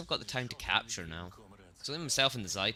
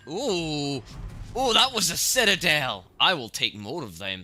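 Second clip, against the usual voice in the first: loud, high burst of delight; excited whoop; 7 semitones higher, 9 dB louder, slower than usual. Real, not acted. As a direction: exclaim at a surprise big hit, then ease down. Gamer reacts to big hit.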